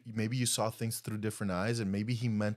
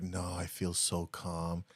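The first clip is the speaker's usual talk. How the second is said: softer, slow mock-serene voice; mock calm; 6 semitones lower, 8 dB quieter, slower than usual. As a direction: imitate someone claiming to be totally calm. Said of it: "She's like".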